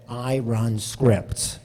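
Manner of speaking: slow, deliberate "I run scripts"; lightly voiced fellow participant, drawn out; a fellow study participant confessing, said slowly; slow retold quote. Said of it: "one of the other guys said".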